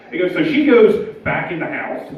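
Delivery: normal talking voice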